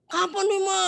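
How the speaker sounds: very high, demanding nag; nagging child. Retold or imitated. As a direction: pester mum about when. Anak menagih janji. (anak menagih janji ke mama dengan nada sangat tinggi)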